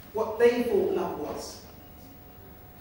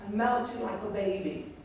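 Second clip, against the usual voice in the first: ordinary talk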